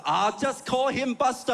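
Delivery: put-on high, slow Chinese-accented dismissal; Chinese-accented mom. Chinese mom dismissing her son's objection, high and slow Chinese accent. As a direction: brush it off in a Chinese mother's accent. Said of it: "do quite a lot is the Chinese accent".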